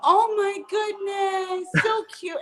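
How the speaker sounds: high, very drawn-out adoring coo; gushing coo. Real, not acted. Guest gushing over the cute porky, high cooing. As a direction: stretch it out, melting over something cute.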